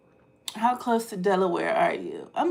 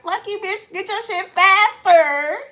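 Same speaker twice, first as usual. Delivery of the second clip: angry retort; 9 semitones higher, 7 dB louder than usual. Retold or imitated. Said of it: "And I'm like".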